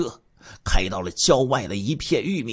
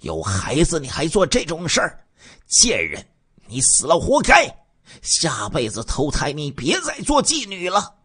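higher, louder furious scolding; angry rant; 6 semitones higher, 4 dB louder than usual. Acted. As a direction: spit contempt at her. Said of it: "我一听更加生气了". (更加生气地大声骂)

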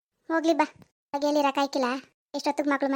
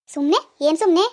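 indignant challenge; 4 dB louder than usual. Acted. Grandmother challenges the word.

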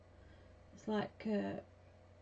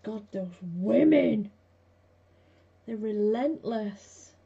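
imitating exasperated husband; 8 dB louder, slower than usual. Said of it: "I think he must think".

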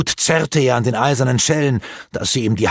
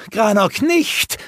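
warning cry; 11 semitones higher, slower than usual. A narrator's reading. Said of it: "warnte ihn Alana".

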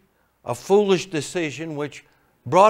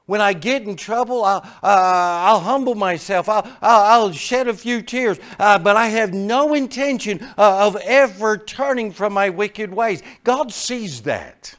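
loud, higher preaching voice; fervent preaching; 7 semitones higher, 8 dB louder than usual. Acted. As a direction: drive the point home to the congregation. Preaching at full force.

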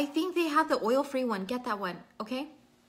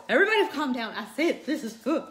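loud, higher mock scolding; mock exasperation; 3 semitones higher, 6 dB louder than usual. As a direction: raise your voice to hush a panicking crowd. Mock scolding of worried viewers.

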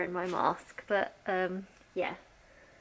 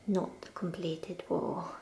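whispering; 3 semitones lower, 8 dB quieter, slower than usual. Real, not acted. Correcting herself under breath.

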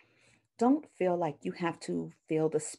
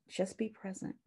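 hushed; 3 semitones higher, 9 dB quieter than usual. Shown as a demonstration. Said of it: "Silence is powerful".

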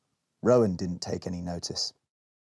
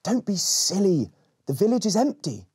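put-on reassuring girl; 9 semitones higher than usual. Rowan reassures Berry.

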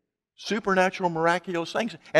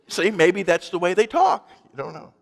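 ordinary talk

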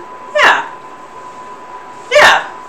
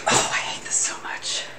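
whispered dread; a real whispered groan of dread; 14 dB quieter than usual. Real, not acted. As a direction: whisper it to yourself in misery. Dreading eating the wasabi.

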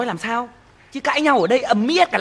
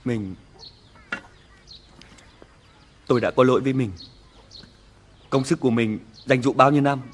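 low, quiet apology; remorseful, low; 10 semitones lower, 5 dB quieter than usual. Acted. Husband apologizes to his wife for losing her savings, low remorseful voice. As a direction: confess with shame, barely above a murmur.

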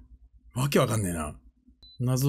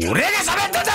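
sudden high-pitched yell; explosive outburst, voice pitched way up; indignant shout; faster than usual. Real, not acted. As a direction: shout over the interruption. Yelling over the clip being played.

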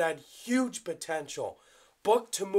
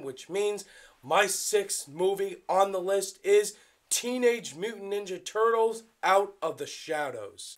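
ordinary talk

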